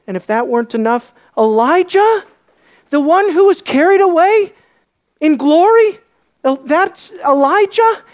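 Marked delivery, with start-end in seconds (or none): 1.4-8.0 high, loud rising questions; astonished disbelief. Acted. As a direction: marvel at who stands before you, pitch soaring. Acts awe at seeing Elijah.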